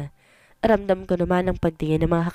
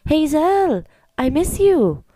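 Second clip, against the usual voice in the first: high, louder call across the room; shouted greeting; 9 semitones higher, 4 dB louder, much slower than usual. Acted. Ex calling out to Hazel, shouted. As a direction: call out her name loudly. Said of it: "biglang may sumigaw".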